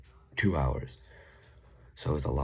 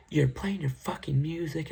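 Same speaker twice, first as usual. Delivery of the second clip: angry retort; 12 semitones higher, 4 dB louder, slower than usual. Retold or imitated. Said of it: "I'm like".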